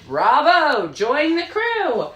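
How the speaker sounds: high, loud, slow cheer; cheerful shout. Acted. The other two welcoming the vulture, a high loud cheer. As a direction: call out a big welcome. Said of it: "the other two shouted".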